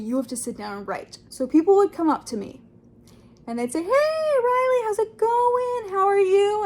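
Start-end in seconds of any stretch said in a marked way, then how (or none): 3.8-6.6 cheery acquaintance. Retold or imitated. Acquaintances greeting her in cafe.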